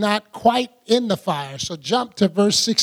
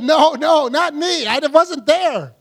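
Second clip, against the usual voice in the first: high, louder protest slowing at the end; flustered denial; 6 semitones higher, 3 dB louder, slower than usual. Acted. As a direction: deny it in a rush, then drag it out. Peter denies again, acted.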